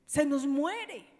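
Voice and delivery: high, very loud impassioned exclamation; forceful preaching. Real, not acted. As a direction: shout it out to the congregation with urgency.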